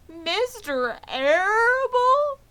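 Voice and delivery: high, loud, slow sobbing question. Acted. Wilbur hears Fern's father is in on it, sobbing high. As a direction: sob the name in disbelief. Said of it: "sobbed Wilbur".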